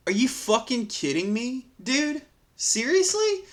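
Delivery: can't believe what he's reading, pitch climbing; incredulous. Real, not acted. Vlogger ranting at the Reddit poster, incredulous and rising.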